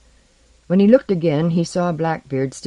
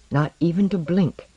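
ordinary delivery, nothing marked

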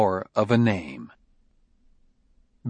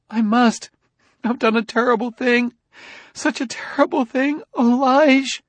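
distraught; 14 semitones higher than usual. A narrator's reading. Jessie breaks down.

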